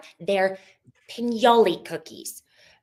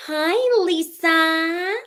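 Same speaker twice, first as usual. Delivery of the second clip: much higher, bright fake-friendly hi; 1 overly sweet greeting; 8 semitones higher, 4 dB louder, much slower than usual. Imitating Monica greeting Lisa, high and sugary. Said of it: "she's like".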